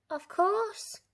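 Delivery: put-on high bright girl's cry; a little girl jumping at the job; high excited yes; eager cry. A narrator's reading. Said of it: "cried Little Red".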